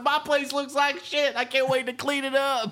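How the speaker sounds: high, silly gullible husband; high mock husband voice. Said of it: "He's like".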